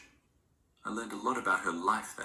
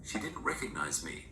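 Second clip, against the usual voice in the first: usual speaking voice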